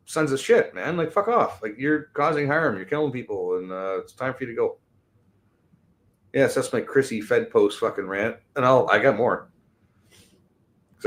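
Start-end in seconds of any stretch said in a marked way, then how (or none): none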